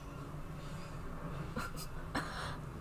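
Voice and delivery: quiet breathy laugh, almost silent; a little airy chuckle to herself; soft laugh. Real, not acted. Laughs at her own joke.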